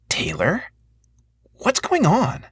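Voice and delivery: worried woman asking what's wrong; worried sister. A narrator's reading. Sister alarmed by guards.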